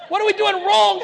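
high, loud mock cry to his wife; hammed-up plea of a parent at wit's end; mock despair. Parent asking his wife what they do wrong, mock shout.

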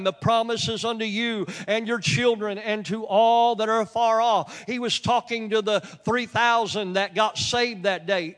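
loud, ringing pulpit voice, driving each phrase home; full-force preaching. Acted. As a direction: preach it out to the whole hall.

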